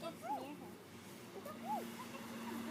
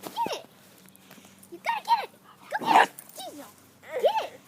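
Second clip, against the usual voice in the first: rising, ever higher and louder calls; excited urging; 8 semitones higher, 8 dB louder than usual. Real, not acted. Owner urging the dog to fetch, building higher and louder. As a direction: build up the dog's courage.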